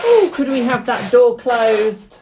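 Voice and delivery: loud, high, drawn-out call. Real, not acted. Streamer asks someone off-mic to close the door, calling out. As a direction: raise your voice to someone across the house.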